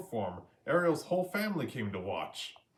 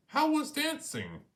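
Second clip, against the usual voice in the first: much higher crab voice; a crab asking eagerly; Sebastian voice. A narrator's reading.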